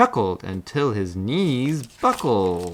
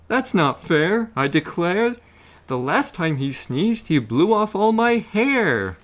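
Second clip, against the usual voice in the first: high, indignant complaining bear; 7 semitones higher, 3 dB louder than usual. A narrator's reading. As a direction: protest in a raised voice. Bear complains about the elephant.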